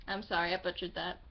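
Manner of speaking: usual speaking voice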